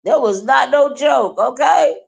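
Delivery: high, loud shrieked leave; mock yell. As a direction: yell it back in mockery. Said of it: "I said".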